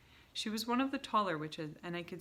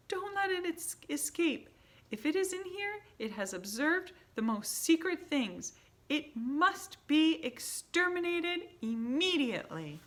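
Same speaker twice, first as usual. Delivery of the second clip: furious command; 11 semitones higher, 7 dB louder than usual. A narrator's reading. Grand High Witch ordering the hunters to exterminate. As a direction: order the kill with fury.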